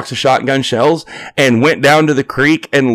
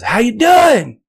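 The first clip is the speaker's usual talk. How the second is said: calling out; 11 semitones higher, much slower than usual. Retold or imitated. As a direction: shout the greeting from the porch. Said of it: "He goes".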